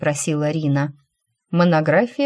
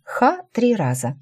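ordinary delivery, nothing marked

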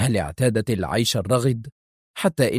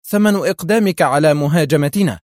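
higher, louder accusing retort; crying out; 5 semitones higher, 6 dB louder than usual. A narrator's reading. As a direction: burst out with the answer after hesitating. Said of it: "قبل أن تهتف". (هتاف حاد)

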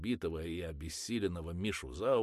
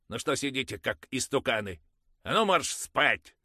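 higher and louder command; loud order; 9 semitones higher, 11 dB louder than usual. Acted. Mother ordering children to bed, loud. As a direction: send the kids to bed sharply.

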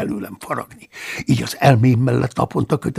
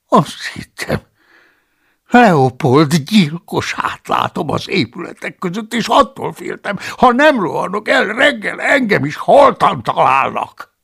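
frightened monk; 7 semitones higher, 5 dB louder than usual. Acted. The cellarius explaining to Paulus why he fled, afraid. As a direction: explain your fear, still trembling.

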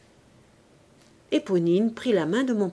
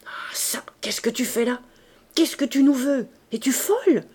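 hushed exclamation; 4 semitones higher than usual. A narrator's reading. Thénardier to his daughter at the gate, hushed outburst. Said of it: "autant qu'on peut s'écrier en parlant bas".

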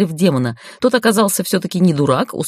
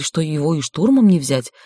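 ordinary delivery

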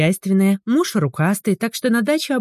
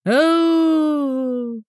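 strained high crow, voice giving out; hoarse rooster crow; 9 semitones higher than usual. A narrator's reading. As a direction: crow until the voice breaks. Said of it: "хрипел уже в конце, сорвав голос".

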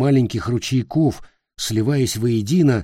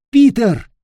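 calling out; 13 semitones higher, 8 dB louder than usual. Acted. Nick calls after Peter.

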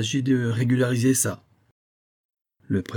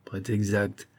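ordinary delivery

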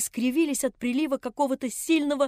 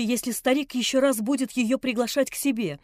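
usual speaking voice

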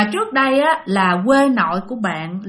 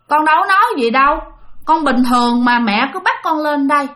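indignant denial; 4 semitones higher than usual. Retold or imitated. Uncle protesting to the shaman that he said nothing. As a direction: insist you did nothing, quickly. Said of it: "Thì cậu nói".

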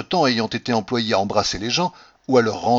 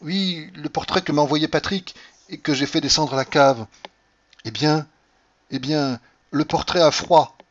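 high, emphatic revelation; wife explaining what troubles her, voice raised in pitch; Cordelia insistent; 6 semitones higher, 4 dB louder than usual. A narrator's reading. Cordelia reveals the portrait's chill. (révélation insistante, voix haute)

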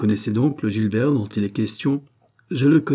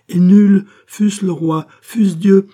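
much louder and higher, triumphant; thundering proclamation; 10 semitones higher, 10 dB louder, slower than usual. Acted. Gilbert declaring to the countess that none escape him, thundering. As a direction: roar out his power.

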